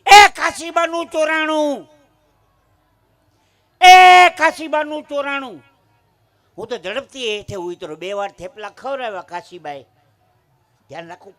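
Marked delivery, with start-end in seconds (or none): 0.0-5.6 high, very loud cry of theft; shouted alarm. Acted. Passenger raising a theft alarm on the train, shouting. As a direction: holler it down the train, drawn out. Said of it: "બૂમ પાડી".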